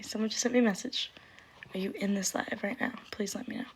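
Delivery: ordinary talk, nothing marked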